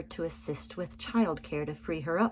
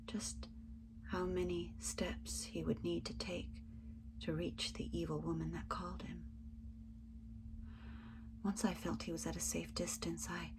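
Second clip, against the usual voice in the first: softer, tense whispered narration; hushed whisper; 9 dB quieter than usual. A narrator's reading. Narrator picturing the guard walking away, in a tense whisper. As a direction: keep it under your breath so nobody hears.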